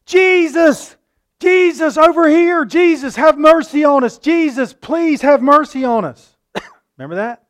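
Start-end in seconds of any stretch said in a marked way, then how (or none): 0.0-6.4 high, loud pleading shout across a distance; desperate cry. Acted. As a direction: call out to Jesus from far off, begging. Lepers crying out to Jesus.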